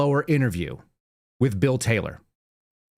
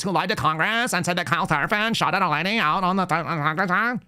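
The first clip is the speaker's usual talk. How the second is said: mocking up-talk; 4 semitones higher than usual. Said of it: "a lady who talked like this".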